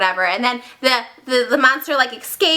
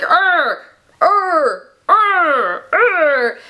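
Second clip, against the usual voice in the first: repeated higher-pitched creature groans; crazed monster roars; 5 semitones higher, 3 dB louder, much slower than usual. Acted. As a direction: growl in bursts like a berserk monster. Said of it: "he's going crazy".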